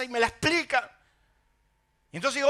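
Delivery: ordinary talk, nothing marked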